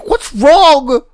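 high, slow indignant wail; exasperated demand. Real, not acted. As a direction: plead it in outrage, drawing out the first words. Exasperated rhetorical question.

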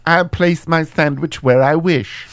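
posh British gadget master; haughty upper-class Q. Acted. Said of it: "I'm Q".

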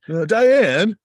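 loud, high-pitched sitcom cry; Ted Danson impression. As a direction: call out the name in a big mock sitcom voice. Imitating Ted Danson's sitcom catchphrase.

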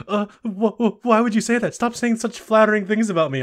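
imitating a flattered, embarrassed protest. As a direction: sputter bashfully, hammed up. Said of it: "then Daigo's all embarrassed".

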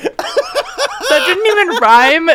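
high, slow, laughing hard; barely holding it together. Real, not acted. Laughing remark.

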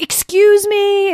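a slow, high, loud "Excuse me" full of suspicion; stern security guard. Retold or imitated. Security guard stopping the young teacher, slow and suspicious. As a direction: stop a kid in the hall with it.

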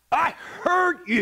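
insistent dad. Retold or imitated. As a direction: insist you heard it. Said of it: "I'm like".

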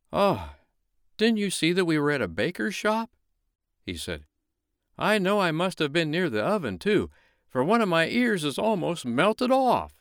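a fussy snowman complaining. Acted. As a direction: snowman fretting about melting. Snowman voiced in read story.

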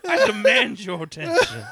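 very high, laughing cat demand. Acted. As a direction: squeak it out like a bossy cat.

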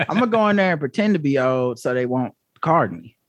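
put-on low, thin old man voice; very old faint voice. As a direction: play a frail old man. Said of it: "You're messing up my bit".

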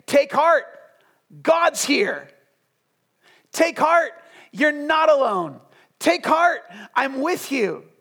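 high, loud preacher's call; rousing proclamation. Acted. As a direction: cry out encouragement in short bursts. Urgent proclamation to freaking-out disciples.

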